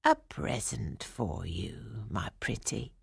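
put-on low, slow, hushed old woman. A narrator's reading. Old woman offers the princess a present, quietly coaxing. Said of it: "said the old woman".

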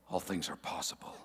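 low, soft voice of God; mock God voice. Shown as a demonstration. Preacher voicing God for the congregation, low and soft. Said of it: "Let me get my God voice on".

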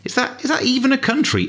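high-pitched baffled disbelief; incredulous viewer. Acted. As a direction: squeak it out, puzzled and incredulous. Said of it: "You think".

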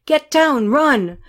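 loud, high urgent command; acted combat shout; soldier's yelled order. A narrator's reading. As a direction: yell for them to take cover. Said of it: "One of the soldiers in the second jeep yelled at them".

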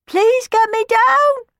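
faint voice. Acted. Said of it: "came the voice again".

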